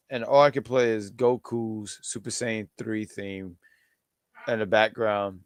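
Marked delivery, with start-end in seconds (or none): none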